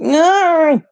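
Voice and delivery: one long, high, loud mock cry; hammed-up despairing refusal; exasperated wail. Retold protest at repeated wrong answer.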